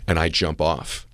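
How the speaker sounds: usual speaking voice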